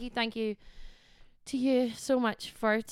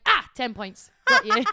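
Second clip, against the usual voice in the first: laughing, then a high loud whoop on got you; gleeful triumph; 3 semitones higher, 7 dB louder than usual. Retold or imitated. Zapping flies, she cheers a loud high win. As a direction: cheer a fly kill like scoring in a game. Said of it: "I'm like".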